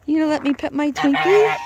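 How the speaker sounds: pet coaxing voice. Real, not acted.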